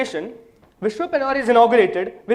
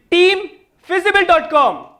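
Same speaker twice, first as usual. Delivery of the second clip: ordinary talk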